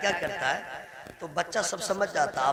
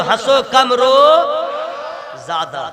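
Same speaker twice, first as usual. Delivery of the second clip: louder; 8 semitones higher, 13 dB louder than usual. Shown as a demonstration. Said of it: "जरा जोर से बोलो".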